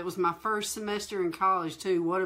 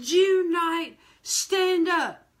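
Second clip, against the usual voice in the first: raised stern command; a touch of a preacher's order; commanding pastor; 7 semitones higher, 3 dB louder, much slower than usual. Retold or imitated. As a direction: call her out by name. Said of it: "he said".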